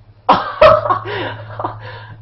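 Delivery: loud, high, breathless burst of laughter; amazed laugh. Real, not acted. Laughing at amazing play.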